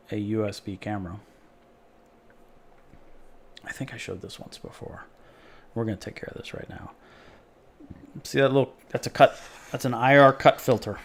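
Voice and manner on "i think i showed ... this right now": half-whispered muttering; whispered aside. Real, not acted. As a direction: mutter it under your breath. Working, unvoiced aside.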